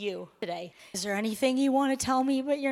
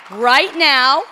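very loud, high, whooping delivery; jubilant announcement; 7 semitones higher, 11 dB louder than usual. Real, not acted. Pastor springing a surprise wedding on church, jubilant announcement. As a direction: shout the surprise out to the whole room.

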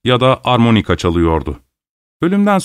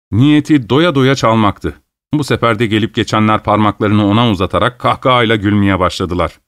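ordinary delivery, nothing marked

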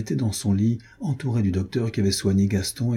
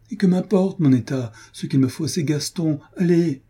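desperate outcry; 8 semitones higher than usual. Acted. Duchess begging the doctor to fetch Gaston, frantic outcry. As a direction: cry out for him in despair.

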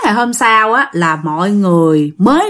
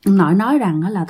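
usual speaking voice

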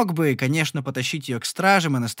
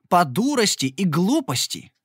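plain reading voice

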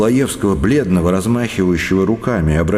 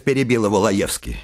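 ordinary delivery, nothing marked

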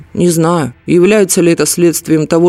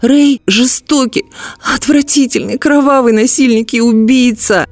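screaming; 8 semitones higher than usual. A narrator's reading. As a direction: shriek out the accusations. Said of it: "вдруг закричала Тарита".

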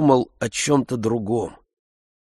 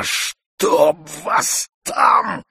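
Zakhar grumbling; 8 semitones higher, much slower than usual. A narrator's reading. Servant grumbling off.

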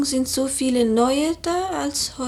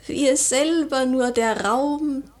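ordinary delivery

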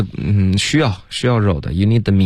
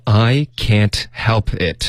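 slow, evenly spaced words; careful slow model reading; slow demo; much slower than usual. Shown as a demonstration. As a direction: read it out slowly for learners. Teacher models the line slowly.

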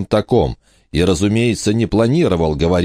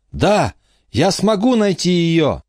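shouting over wind; 12 semitones higher than usual. A narrator's reading. Michael shouting resolve.

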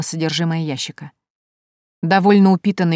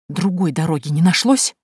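usual speaking voice

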